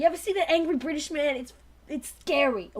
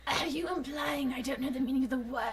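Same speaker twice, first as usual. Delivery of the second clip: Dinobot whisper; 4 semitones lower, 8 dB quieter, faster than usual. Acted.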